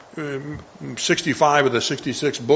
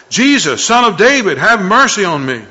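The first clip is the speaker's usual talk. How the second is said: loud desperate cry for mercy; a blind beggar crying out loud to Jesus; 7 semitones higher, 8 dB louder than usual. A narrator's reading. Blind beggar calling out loud to Jesus for mercy. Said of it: "he began to cry out and say".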